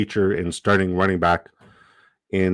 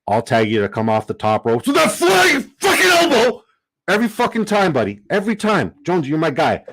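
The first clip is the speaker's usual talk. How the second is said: much higher and louder wrestling-hype yell; hyped shout; 8 semitones higher, 5 dB louder than usual. Real, not acted. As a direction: fire up your tag team partner. Hyping up his draft buddy.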